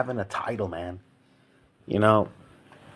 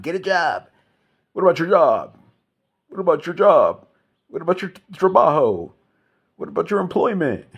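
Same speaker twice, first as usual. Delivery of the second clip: high, loud mock questioning; high mock interrogation; mocking nagging voice; 9 semitones higher, 5 dB louder than usual. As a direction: pepper him like a nagging busybody. Mocking what people say.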